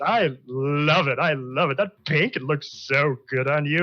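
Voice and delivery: gushing awe with stretched, swooping words; effusive drow host. Acted. Drow host admiring the guests' amazing outfits, gushing. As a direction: drool over every outfit, drawing out the praise.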